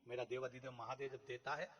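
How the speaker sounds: ordinary talk, nothing marked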